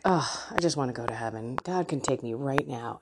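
low, drained wish for heaven; lightly imitated tired wish. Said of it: "remember saying that to my husband".

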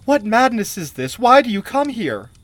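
high, loud bewildered questions; frightened queen at full voice; desperate cry. A narrator's reading. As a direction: cry out as if losing grip. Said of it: "she cried desperately".

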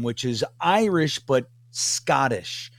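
normal talking voice

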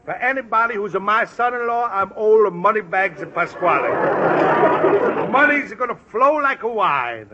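put-on hearty, broad Italian accent; a jovial schemer in a thick Italian accent. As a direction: Pasquale greeting his friend in a big Italian accent. Pasquale reassures Luigi.

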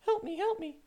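high squeaky mock plea for help; a falsetto cry for help, mocking the man fleeing to the police; mock helpless cry. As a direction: squeal it like a scared man running.

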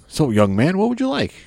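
put-on a gracious old ring announcer welcoming a fan; Howard Finkel's voice. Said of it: "he's like".

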